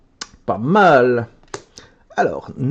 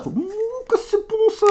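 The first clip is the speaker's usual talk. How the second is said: very high, soft squeal of delight; delighted coo; 6 dB quieter than usual. Real, not acted. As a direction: swoon over the card. Delighted by a rare card. (roucoulement ravi très aigu)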